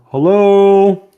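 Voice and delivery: loud, high drawn-out greeting; calling out hello. Acted. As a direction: call into an unknown room in character. Character steps in, calls hello.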